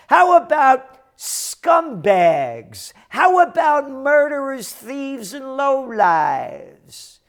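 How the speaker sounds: slow contemptuous rant; drawn-out angry insults. Real, not acted. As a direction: drag out each insult with scorn. Ranting at lawmakers.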